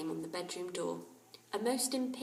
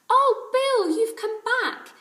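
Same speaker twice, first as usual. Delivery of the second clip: very high, loud happy greeting; delighted; 14 dB louder than usual. A narrator's reading. Children welcoming Bill home, delighted. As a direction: overflow with delight.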